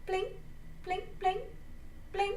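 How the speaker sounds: tiny high-pitched blips; little rising blips, spaced apart; stunned blinking sound. Acted. Stunned family blinking after the breakup news, tiny high blips.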